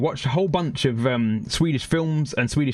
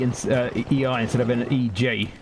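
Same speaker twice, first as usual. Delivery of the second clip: usual speaking voice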